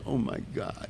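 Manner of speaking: breathy unvoiced gasp of shock; hushed oh my God. Team leader stunned by the count, whispering. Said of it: "He goes".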